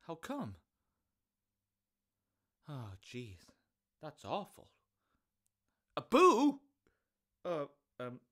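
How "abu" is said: calling out. Acted. Shouting for monkey.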